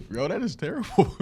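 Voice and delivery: laughing. Real, not acted. Reacting laughing to story.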